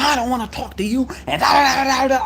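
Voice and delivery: high, loud mock rant from the dispatcher; retold yelling. The upset dispatcher refusing to hear him, mock loud rant. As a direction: imitate her going off at him. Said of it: "she yelling".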